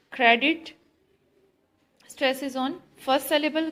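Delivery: usual speaking voice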